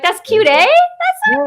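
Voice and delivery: Canadian accent with a high, loud eh; a bright Canadian "That's cute, eh?". Shown as a demonstration. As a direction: play up the Canadian eh. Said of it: "Sometimes I throw an A".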